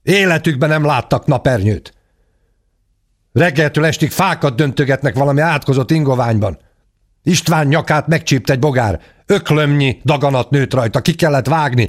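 magasabb, felháborodott kiabálás; a szemébe vágja az igazat. A narrator's reading. Ádám felháborodva magyaráz. (felháborodott gyerekhang)

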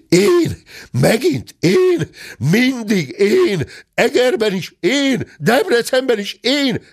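high, drawn-out wails of protest; indignant protest. A narrator's reading. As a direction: howl each "én" like a wronged victim. Wrongly accused bear protests.

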